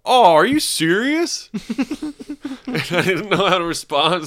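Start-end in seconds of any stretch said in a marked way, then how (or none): 0.1-1.5 high, loud, drawn-out disbelief; incredulous. Retold or imitated. Interrupted man in the stall to whoever farted, incredulous. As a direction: imitate an aghast guy, stretching it out. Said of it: "the dude just goes".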